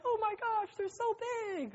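very high doting voice; gushing relatives. Retold or imitated. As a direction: squeal it like relatives seeing the kids. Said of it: "They're like".

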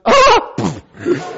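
short, high, startled yelp; mock alarmed cry. Video guy watching the crash landing, high startled yelp. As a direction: yelp in comic horror.

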